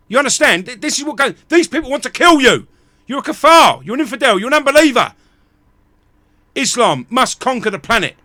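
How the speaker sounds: loud, high, furious tirade; real angry outburst at the listener; furious rant. Real, not acted. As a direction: hammer every line in real rage. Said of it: "It's the frustration coming out".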